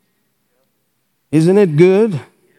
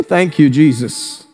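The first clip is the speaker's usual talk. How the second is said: normal talking voice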